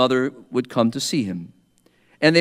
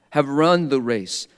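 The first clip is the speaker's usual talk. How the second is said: ordinary talk, nothing marked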